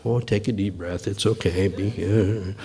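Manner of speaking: soft, soothing tone; calming. Retold or imitated. Mediator calming an upset colleague, softly soothing. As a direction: settle him down gently. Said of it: "trying to calm him down".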